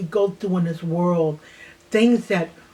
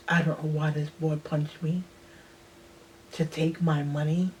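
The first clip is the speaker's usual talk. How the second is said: ordinary talk, nothing marked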